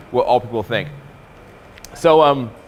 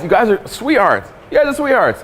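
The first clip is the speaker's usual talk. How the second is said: high, sing-song voice mocking nice guys; high sweet mocking; 8 semitones higher, 4 dB louder than usual. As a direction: coo it like talking to a puppy.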